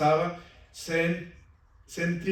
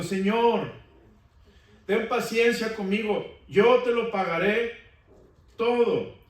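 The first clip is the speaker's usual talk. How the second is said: higher, pleading plea for patience; pleading servant; 5 semitones higher, 4 dB louder than usual. Acted. Kneeling servant begging his lord for patience, pleading voice. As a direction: beg the master for time.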